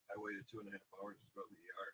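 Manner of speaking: chewing while talking; talking through a mouthful of food; mouth full. Real, not acted. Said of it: "I was chewing my food and I had the microphone up here".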